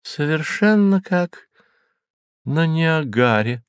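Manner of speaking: tinkling crystal voice, slow; tiny tinkling snowflake sprite. A narrator's reading. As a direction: ring it out like crystal. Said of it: "прозвенел хрустальный голосок госпожи Мозес".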